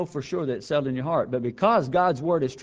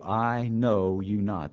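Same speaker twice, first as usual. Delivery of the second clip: each word slow and weighty, I know you not; solemn stress; 4 semitones lower, much slower than usual. Acted. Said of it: "where Christ says".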